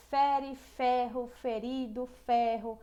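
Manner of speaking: Fere, ferro slow, leaning on each fe; stressing fe. Shown as a demonstration. Teacher slowly points out the repeated fe. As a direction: spell out the fe sound word by word. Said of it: "A repetição do F E".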